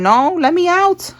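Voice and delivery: high, slow mock plea to be let out; crushed card pleading. Reader voices the squashed DNA test card, a high, slow mock plea. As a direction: whine it like something trapped begging. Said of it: "Like, it's like".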